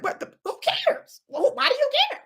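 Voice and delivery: high, incredulous jab at commenters; incredulous taunt. Real, not acted. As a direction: squeak it out in disbelief.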